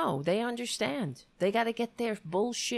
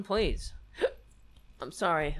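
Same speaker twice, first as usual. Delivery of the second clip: hiccup breaks in speech; talking through hiccups; 5 semitones lower, 4 dB quieter than usual. Real, not acted. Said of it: "I'm hiccuping".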